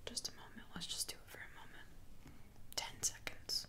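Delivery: hushed whisper for the silence. Real, not acted. Reader calls for silence in a whisper. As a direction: breathe it out, no voice. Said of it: "see how I'm purposely slowing myself down".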